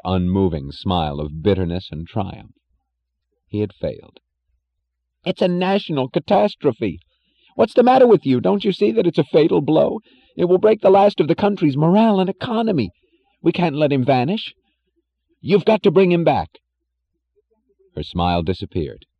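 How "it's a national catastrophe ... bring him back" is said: high, loud, frantic man pouring out bad news; panicked Jim. A narrator's reading. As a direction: babble it in rising, angry alarm.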